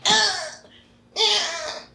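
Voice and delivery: high, whimpering imitated cries. Imitating the boy whimpering in the bathroom, high cries. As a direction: mimic a frightened child's whimper. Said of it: "And he's like".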